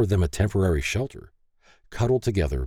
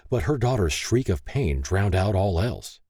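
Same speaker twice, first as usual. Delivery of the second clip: plain reading voice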